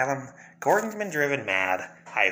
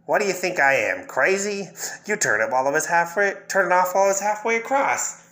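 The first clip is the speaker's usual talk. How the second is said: indignant inmate; 8 semitones higher, 3 dB louder than usual. Acted. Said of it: "He says".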